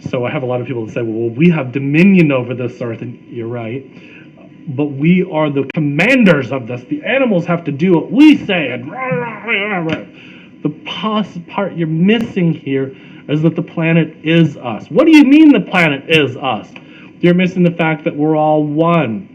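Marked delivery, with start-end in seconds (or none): 1.0-3.0 louder voiced objection; insistent objector. Speaker quoting people who argue, insistent objector. 4.7-10.0 loud, higher mocking bluster. Speaker mimicking people who claim command of animals, mock bluster. 14.9-16.7 loud, high challenge; indignant objector. Acted. Objector questioning that the planet is us, indignant.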